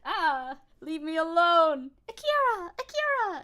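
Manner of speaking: high, loud mock yell; hammed-up anime film cry, drawn out; imitated movie scream. Streamer imitating TikTok clip from Akira, high mock yelling. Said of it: "from the movie Akira".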